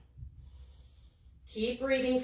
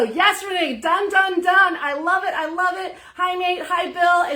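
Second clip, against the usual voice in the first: excited; 6 semitones higher, 8 dB louder than usual. Real, not acted. Celebrating members posting done.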